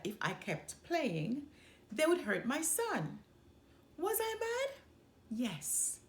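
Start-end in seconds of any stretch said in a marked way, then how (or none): none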